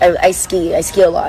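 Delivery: ordinary delivery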